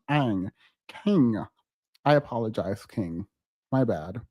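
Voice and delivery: ordinary talk, nothing marked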